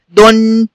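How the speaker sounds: short u in دن, then hum the n; damma tanween. Shown as a demonstration. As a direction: say دن as "dun" with a held n. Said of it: "يبقى دي تنطق إيه؟". (نطق دن "دُنْ" بنون ساكنة)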